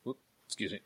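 coughing, choked up; choking on a drink. Real, not acted. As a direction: caught mid-choke, strained. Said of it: "Choking on something".

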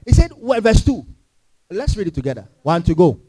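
normal talking voice

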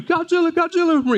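high-pitched mock cry of alarm; cartoonish high squeal of alarm; panicked movie crowd. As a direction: imitate a monster-movie crowd calling out. Mimics fleeing crowd in Godzilla movie.

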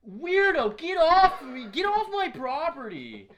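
very high, loud mock shriek; hammed-up, high yell of rejection; disgusted outburst. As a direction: shout her away in comic revulsion. Acted repelling of Amy.

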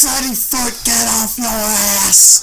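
high, loud, slow scolding shout; mock Italian mama yell. Italian mama yells at lazy son Freddy, hammed-up scolding shout. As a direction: yell like an exasperated Italian mother.

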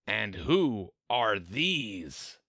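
slow, drawn-out royal demand; stately, deliberate queen voice; imperious queen. A narrator's reading. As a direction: question them slowly as a haughty queen. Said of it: "said the queen".

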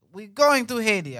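mock Haitian-accented parental announcement; Haitian parents. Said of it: "I asked my Haitian parents".

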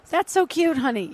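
gushing mom. Retold or imitated. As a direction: coo it like a doting parent commenting online. Imitating own gushing comments to kids.

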